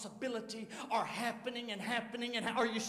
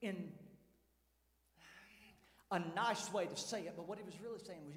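usual speaking voice

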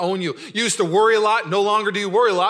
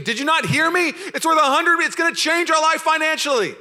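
much higher, loud insistent yell; excited yelling; 11 semitones higher, 6 dB louder than usual. Retold or imitated. Answering family, yelling the news back much higher and louder. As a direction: shout back the news at them. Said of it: "Why are you yelling? Why are you screaming?".

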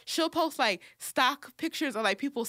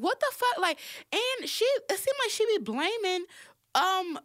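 outraged; 9 semitones higher than usual. Real, not acted. Outraged rant about Iyanla.

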